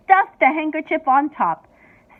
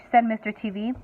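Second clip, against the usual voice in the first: usual speaking voice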